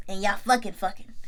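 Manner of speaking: ordinary talk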